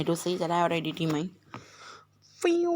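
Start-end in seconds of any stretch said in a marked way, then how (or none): 2.4-2.7 high whoosh. Real, not acted. Excited reaction to gacha pull.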